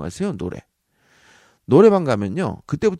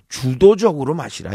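emphatic advice; 4 semitones higher, 8 dB louder than usual. Shown as a demonstration. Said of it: "이거야, 주도적으로".

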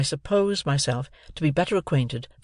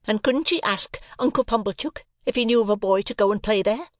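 higher, biting sarcastic rant; sarcastic tirade; 7 semitones higher than usual. A narrator's reading. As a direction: pile on the scorn.

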